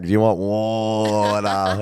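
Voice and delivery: drawn out, slow country Australian "Do you want water?"; slow rural Aussie drawl. Retold or imitated. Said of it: "It's like".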